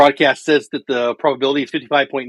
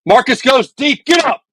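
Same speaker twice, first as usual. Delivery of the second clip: real excited yell, much higher and louder; excited shout; 10 semitones higher, 10 dB louder than usual. Real, not acted. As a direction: yell it as the ball flies out. Home run by his team.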